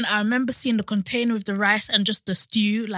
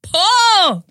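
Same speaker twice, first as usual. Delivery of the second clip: high, loud, slow dramatic moan; mock wail of poverty; 7 semitones higher, 9 dB louder, much slower than usual. Acting out the broke days before payday, hammed-up loud moan. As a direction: ham up being broke, dragging the words out.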